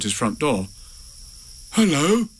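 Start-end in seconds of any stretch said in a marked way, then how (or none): 1.7-2.3 higher-pitched calling greeting; Pooh. A narrator's reading. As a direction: call out hopefully into the dark. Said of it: "said Pooh".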